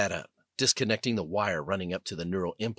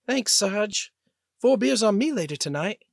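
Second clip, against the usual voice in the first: much higher voice, light and grateful; relieved female pilot; 12 semitones higher, 3 dB louder than usual. Acted. Pilot thanking her sergeant after the rescue, high and relieved. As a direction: thank him with a relieved grin.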